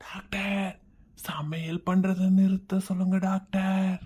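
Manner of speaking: slow, hushed prompting whisper; feed the doctor the line under the breath; soft, drawn-out conspiratorial whisper; secret whisper. Acted.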